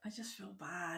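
very soft, guilty near-whisper. Real, not acted. Co-host owning up about the shirt pick, whispering. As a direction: say it small and hushed. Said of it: "Why are you whispering?".

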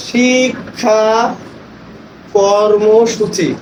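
slow, drawn-out syllables in শিক্ষা কর্মসূচি; chart-reading demo. Shown as a demonstration. Trainer models reading a chart to teachers, slow and drawn-out. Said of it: "এখানে কি লেখা আছে বলবেন".

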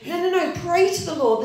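high, loud frightened pleading; a crowd pleading with Samuel in panic; panicked plea. Acted. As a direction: beg in fear of the storm. People crying out in storm.

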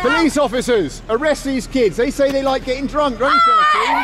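high-pitched mock appeal, rising to a shout; mock calling out. Man jokingly reporting drinking kids to police officers, mock calling out. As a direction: call out in pretend outrage, pitch climbing.

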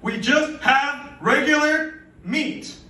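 high, loud exasperated rant; fed-up yell. Retold or imitated. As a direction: rattle off the list like you're about to snap. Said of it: "I wanted to yell at this lady".

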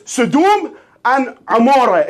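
"Sodom and Amora" said slowly, the Arabic way; Arabic pronunciation. Shown as a demonstration. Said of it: "In, in Arabic".

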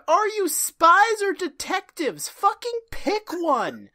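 high, slow shouting, laughing at the end; mock yell. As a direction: yell it out stretched and exasperated. Mirror Genie berates Charlie's Angels.

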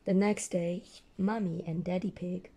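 usual speaking voice

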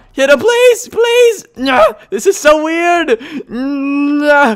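very high, strained pleading; high-pitched gamer desperation; desperate shout. Real, not acted. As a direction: squeal in frantic frustration. Frantic, failing to land hits.

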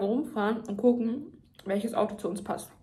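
chewing while talking; talking between bites; mouth full. Real, not acted. Seen 2: Bite at -9, jaw and lip chewing motions continue while talking.

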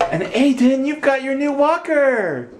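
high delighted praise; excited cheering. Real, not acted. Cheering toddler's new walker.

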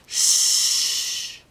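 long, loud "shh"; urgent warning shush; cautioning shush. A narrator's reading. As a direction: hush them with a drawn-out hiss. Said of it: "he cautioned".

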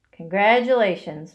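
ordinary delivery

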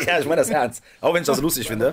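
half-laughing apology; trying to stay serious while laughing. Real, not acted. Laughs at own joke about Horne.

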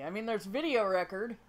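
ordinary talk

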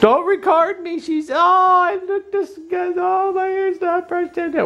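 high, slow whiny protest; mock high whiny voice. Mother protesting being filmed, high and whiny. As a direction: whine it out playfully, way up high.

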